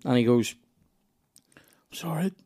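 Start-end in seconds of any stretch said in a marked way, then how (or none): 1.9-2.3 hushed, whispery mock modesty; playing it down. Comic downplaying a great gig to his mate, whispered. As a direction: brush off praise in a small, higher voice. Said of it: "Playing it down".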